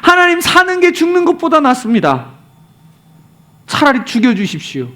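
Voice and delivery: acted exasperated outburst, starting high; high-pitched complaint easing into resignation; Jonah. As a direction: gripe at God in mock annoyance. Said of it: "짜증을 확 내고 화를 냅니다".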